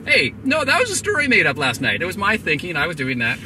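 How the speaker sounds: high, loud, startled outburst easing down; stunned protest. Retold or imitated. Storyteller reliving his shock at the mentor's dream, stunned protest. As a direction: blurt it out, amazed and protesting.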